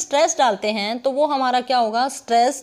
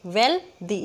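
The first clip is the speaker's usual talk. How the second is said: "Wealthy" broken as वेल, दी; split syllables; 3 semitones lower, slower than usual. Shown as a demonstration. Teacher counts syllables aloud, "wealthy" in two parts. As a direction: pause inside "wealthy" after वेल. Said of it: "इसमें भी दो हैं".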